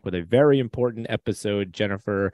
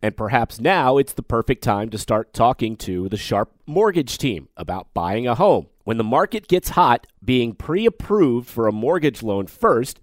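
ad read; 3 semitones higher than usual. Acted. Host reads sponsor ad.

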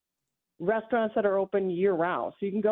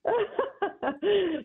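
laughing; 13 semitones higher than usual. Real, not acted. Caller laughs at host's joke.

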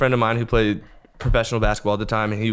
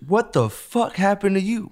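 much higher, slower shocked question; shocked cousin; 10 semitones higher than usual. Retold or imitated. Cousin sees the swollen eye, shocked and high. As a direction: recoil at the injured face.

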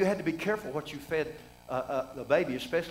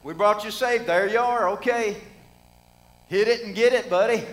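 careless parents; 4 semitones higher, 6 dB louder than usual. Retold or imitated. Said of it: "The parents said".

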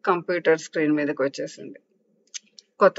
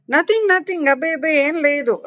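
flustered denial; 8 semitones higher than usual. Acted. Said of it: "అంది అనన్య".

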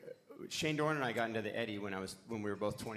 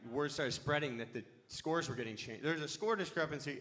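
usual speaking voice

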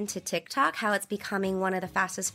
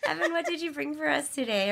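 laughing; 7 semitones higher, faster than usual. Real, not acted. Laughing after being caught eating.

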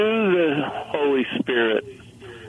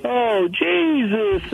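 slow, high mock wail; anguished cry; 8 semitones higher, slower than usual. Caller imitates people crying out to Jesus, anguished. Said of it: "we cry out in anguish".

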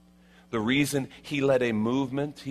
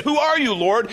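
high, loud startled cry of Saul; 8 dB louder than usual. Acted. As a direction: cry up to the voice from heaven. Saul voiced crying out.

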